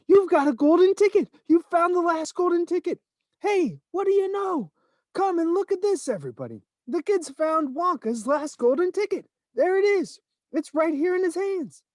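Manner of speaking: can't contain the thrill, calling to all; frantic excitement. A narrator's reading.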